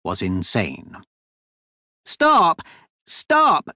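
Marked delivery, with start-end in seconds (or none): 2.0-3.7 high, loud urgent cry; shouted. A narrator's reading. Duchess ordering the carriage to halt, high shout. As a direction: shout it sharply, pitch leaping up. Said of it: "she shouted".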